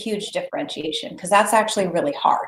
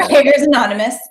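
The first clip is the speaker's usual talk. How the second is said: normal talking voice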